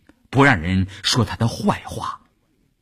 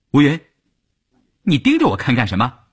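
higher, quick accusing demand; sharp challenge; 4 semitones higher, faster than usual. A narrator's reading. Woman challenging Wu Yun at a struggle meeting, sharp. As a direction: confront them with a quick, pointed question.

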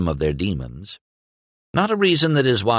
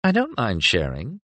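Mira voice; 5 semitones higher than usual. A narrator's reading. Mira flirting.

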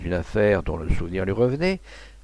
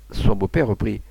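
ordinary delivery

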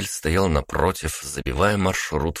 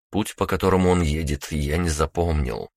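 plain reading voice

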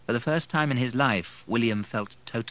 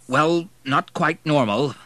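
slow, louder, careful admission; hesitant; 7 semitones higher, 6 dB louder, slower than usual. A narrator's reading. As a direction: hedge slowly before answering. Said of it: "He, too, hesitated".

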